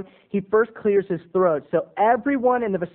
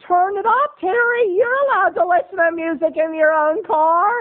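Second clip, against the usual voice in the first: very high, louder squeal; mom; 13 semitones higher, 6 dB louder than usual. Retold or imitated. Mom scolding over the radio, very high loud. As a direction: shriek it like an outraged mom. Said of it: "my mom goes".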